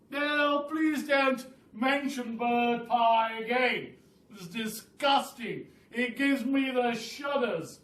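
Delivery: high, slow, pleading bird voice; disgusted roly-poly bird. Acted. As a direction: beg them never to mention it again.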